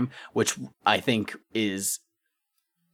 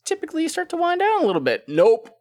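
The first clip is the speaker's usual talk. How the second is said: high, fast, loud burst; excited; 6 dB louder than usual. Real, not acted. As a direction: build it up with glee. Joking about track pacing.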